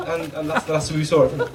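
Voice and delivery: ordinary talk, nothing marked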